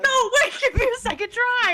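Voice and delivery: high, loud pleading through laughter; real giggly, squealed protest; laughing protest. Real, not acted. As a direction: beg for a retry while cracking up. Pleads for retake while laughing.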